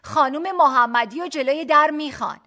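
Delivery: high, loud announcement across a hall; loud call. A narrator's reading. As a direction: call out for someone in a crowd. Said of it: "دوباره همان خانم صدایم کرد". (صدا زدن با صدای بلند)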